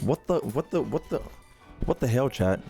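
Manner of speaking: ordinary talk, nothing marked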